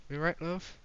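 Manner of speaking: high, lilting greeting; a hint of a chirpy desk clerk; lightly voiced desk officer. Voices police desk character.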